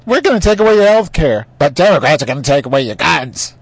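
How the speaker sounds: cartoonish high-pitched politician voice; mock Republican. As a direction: blare it in ridicule. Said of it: "they're blatantly saying".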